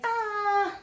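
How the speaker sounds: mock-puzzled shrug, pitched high; unsure shrug. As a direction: toss it off like a shrug. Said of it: "And I'm like".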